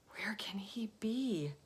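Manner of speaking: whispered, slow, anxious; worried murmur. Acted. Old lady waiting for Alexander, whispered worry. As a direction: breathe it into the night, fretting.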